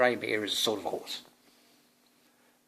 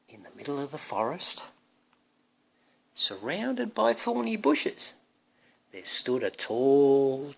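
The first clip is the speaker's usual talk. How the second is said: storybook reading; 2 semitones higher, 4 dB louder than usual. Acted. Parent reading to Thomas, bright storybook voice. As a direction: paint the tower for a small child.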